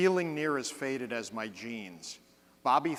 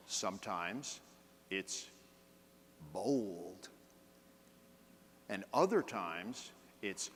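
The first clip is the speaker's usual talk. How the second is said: slow, paused, tune shifting word by word, softer; varied intonation; 3 semitones lower, 7 dB quieter, slower than usual. Shown as a demonstration. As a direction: show each font with the voice alone. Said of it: "I just changed the speed and the intonation".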